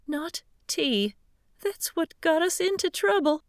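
fluttering, distressed Southern lady begging for help; quavering old lady. Acted. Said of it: "Miss George's quavering voice".

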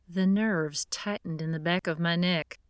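ordinary delivery

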